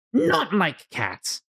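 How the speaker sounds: high, slow, indignant mouse squeak; shrill, harsh mouse. Acted. Offended mouse answering Alice, shrill and harsh. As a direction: spit out the words like an outraged mouse. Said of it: "in a shrill, harsh voice".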